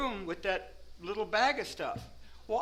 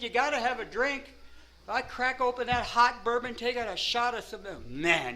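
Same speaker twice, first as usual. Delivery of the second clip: ordinary talk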